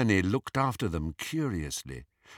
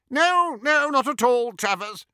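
high, loud, flustered reassurance; hasty backtracking; 5 dB louder than usual. A narrator's reading. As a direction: rush to smooth over a blunder. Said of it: "said Hermione quickly, trying to cover up her mistake".

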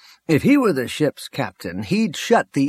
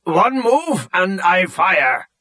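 high-pitched shouted threat, drawn out; shouting; 6 semitones higher, slower than usual. A narrator's reading. Piggy holds the bridge crew at gunpoint, shouting. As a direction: shout a slow, high warning to freeze. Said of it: "Piggy shouted".

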